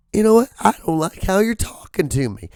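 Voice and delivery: high, squeaky indignation; mock outraged squeal; squealing diva complaint. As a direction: play an offended star, voice shooting up. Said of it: "Now when he squeals like that".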